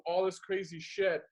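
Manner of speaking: usual speaking voice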